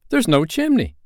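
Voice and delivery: put-on high, insistent boy's voice; high-pitched storybook Peter; Peter's voice. A narrator's reading. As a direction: pipe up high with an urgent complaint. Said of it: "Peter said".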